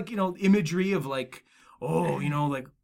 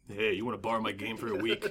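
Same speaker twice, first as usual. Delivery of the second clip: lower, easy-going offer; casual offer; 6 semitones lower, 5 dB quieter than usual. Retold or imitated. Host imitates offering the girl his game, casual. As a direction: play it cool lending the game. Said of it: "Did you give her that voice?".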